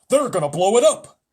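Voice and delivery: loud alarmed cry; army chief crying out in alarm; cried out. A narrator's reading. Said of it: "cried the chief of the army".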